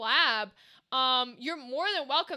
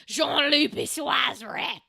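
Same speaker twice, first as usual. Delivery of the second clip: a hammed-up gruff French voice, slow and loud; gruff French accent; 6 dB louder, slower than usual. Streamer hamming up a French name for chat, mock gruff accent. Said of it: "I love how my French is like a gruff Italian man".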